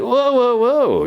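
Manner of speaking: high, loud startled whoa whoa whoa; a sudden stop-everything cry of alarm. Retold or imitated. Junk blocking his driveway.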